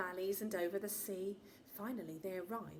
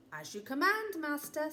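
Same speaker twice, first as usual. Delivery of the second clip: mock-grand genie; 5 semitones higher, 7 dB louder than usual. Genie grants the wish.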